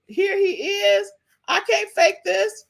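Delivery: showing viewers the proof, high and slow; excited. Real, not acted. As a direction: announce it like a gotcha.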